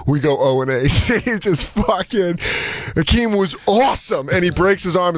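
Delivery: ordinary talk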